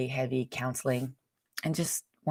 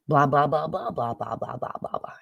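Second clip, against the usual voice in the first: gibberish blah blah, a bit louder at first; mock gibberish; 4 dB louder than usual. Steph's brother explaining, rendered as mock babble. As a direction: mimic the words as meaningless babble. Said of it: "Are you saying blah, blah?".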